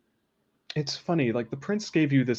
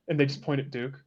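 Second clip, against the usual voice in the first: normal talking voice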